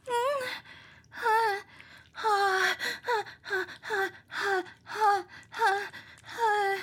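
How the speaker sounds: high, breathy short pants; rapid panting. Acted. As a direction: pant in quick bursts.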